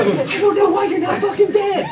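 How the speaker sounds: high-pitched comic bewilderment; freaked-out disbelief. Retold or imitated. Husband gaping at his opened-up wife, high freaked-out disbelief. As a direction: blurt it high, utterly baffled.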